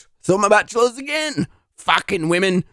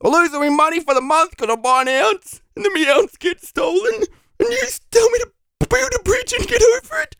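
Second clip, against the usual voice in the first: climbing higher into a crying whine; tearful rant; 8 semitones higher than usual. Acted. Applicant pleading with interviewer over his stolen ounce, tearful. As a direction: break down while complaining, pitch rising.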